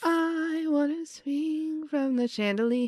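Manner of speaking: put-on high, slow, dreamy kid voice; giddy kid. She voices the floating boy wanting to swing, high and slow. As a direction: float the words up like a delighted child.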